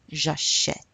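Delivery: "j'achète" with a clear open "eh" before the T; open è sound. Shown as a demonstration. Teacher repeating for students, "j'achète" with open "eh". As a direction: let the "eh" in "j'achète" be heard. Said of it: "You hear the eh".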